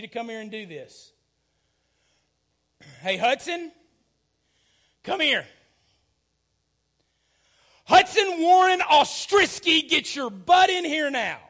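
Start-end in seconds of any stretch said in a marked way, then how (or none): 3.0-5.5 put-on loud, high call to a child; firmer parental call. Dad calling his kid a second time, firm call. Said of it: "You see the difference?". 7.8-11.4 full-name scolding shout, high and very loud; stern parent yelling. Acted. Dad summoning his kid by full name, stern yell. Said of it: "us talking to our kids".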